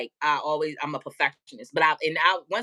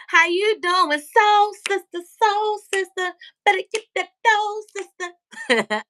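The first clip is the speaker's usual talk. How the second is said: much higher, drawn-out sing-song hello; sing-song soul sister greeting; 13 semitones higher, 5 dB louder, slower than usual. Retold or imitated. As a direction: greet her like an overly sweet auntie. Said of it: "I saw Mama Lie right in my head".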